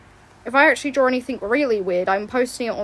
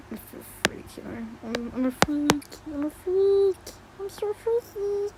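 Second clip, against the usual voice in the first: creepy freaky voice; 5 semitones higher, slower than usual. Pretend.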